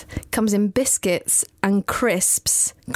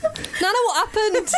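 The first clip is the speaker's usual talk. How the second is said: shocked grandchild; 12 semitones higher than usual. Pretend. Grandchild shocked at tattooed gran.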